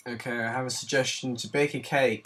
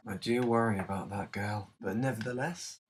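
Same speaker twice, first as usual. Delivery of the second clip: normal talking voice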